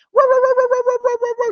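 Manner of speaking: high yappy mock barking; dog barking imitation. Shown as a demonstration. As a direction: yap like small dogs behind the door.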